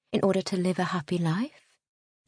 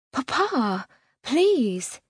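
higher, louder desperate plea; pleading; 5 semitones higher, 4 dB louder, much slower than usual. A narrator's reading. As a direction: beg him, voice rising. Daughter begs father.

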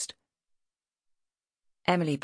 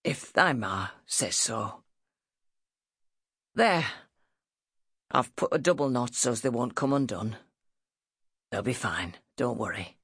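low, halting northern father; husky ailing father; 4 semitones lower than usual. A narrator's reading. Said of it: "His voice was husky".

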